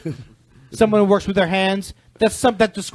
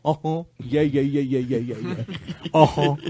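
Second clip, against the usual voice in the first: uh-huh guy; 6 semitones lower, 4 dB quieter than usual. Pretend. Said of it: "He's the uh-huh guy".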